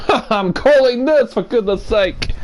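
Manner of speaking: laughing protest. Real, not acted. Commentator insisting he is busy calling this, laughing protest. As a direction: burst out laughing, then protest.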